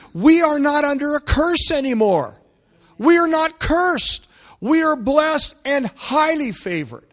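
high, loud declaration; emphatic preaching. Real, not acted. Preacher telling the church what revival is, emphatic. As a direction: proclaim it with full pulpit force.

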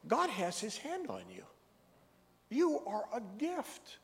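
high, soaring preacher's delivery; full-force preaching. Acted. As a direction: lift it up and proclaim it. Preaching the blessing to him.

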